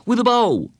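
put-on high, excited boy's exclamation; excited and urgent, high-voiced; exclaiming. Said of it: "Bradley exclaimed".

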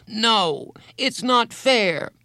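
put-on very high, drawn-out child's protest; upset outburst. A narrator's reading. Boy refuses to accept her leaving, upset outburst. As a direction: wail the protest high and slow.

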